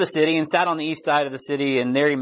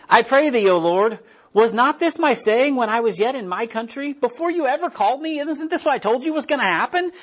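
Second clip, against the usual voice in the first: Jonah pleading; 6 semitones higher than usual. Acted. Jonah's angry prayer read out.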